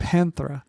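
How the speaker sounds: "Panthera", th in the middle; mispronounced. Retold or imitated. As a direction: repeat "Panthera" with th in place of t. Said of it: "Sometimes people would say".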